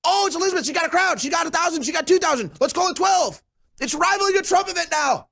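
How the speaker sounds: very high, squealing mock excitement; hammed-up high giddy squeal; mock giddy Democrats. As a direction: gush and gasp over a tiny crowd.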